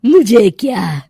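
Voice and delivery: menacing witch. Acted. Witch plots against the villagers, slow and menacing. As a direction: slowly promise to teach them a lesson.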